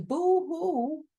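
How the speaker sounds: a playful retold sob, not real tears; mock boo-hoo sob. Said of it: "And I was crying".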